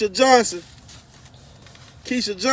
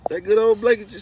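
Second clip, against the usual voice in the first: loud proud call; 7 dB louder, faster than usual. Real, not acted. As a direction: announce it to the room. Calling out praise.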